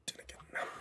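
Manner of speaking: breathy whisper of the catchphrase. Shown as a demonstration. Repeating the line, first as a whisper. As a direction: whisper it to yourself.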